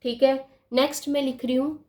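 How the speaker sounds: ordinary delivery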